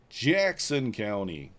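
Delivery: "Jackson County" stretched slowly, each word lingered on; slow, savoured "Jackson County"; drawn out. Real, not acted. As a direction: say "Jackson County" at half speed. Repeats school name, drawn out.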